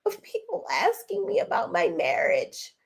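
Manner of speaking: hammed-up whimpering, as if turning on the waterworks; mock tearful. Tisha pleading about questions on her marriage, in exaggerated tearful whine. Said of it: "she put the tears on".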